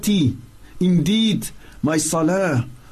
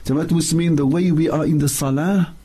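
ordinary delivery

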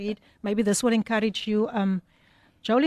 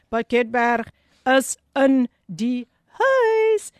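slow, stretched radio hype call; hype shout-out; 4 dB louder, much slower than usual. Acted. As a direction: draw out huis like a DJ. Host hyping a listener's town.

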